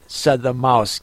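ordinary delivery, nothing marked

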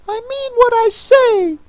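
very high, indignant squeak; offended Mock Turtle. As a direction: insist huffily in a tiny high voice. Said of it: "replied in an offended tone".

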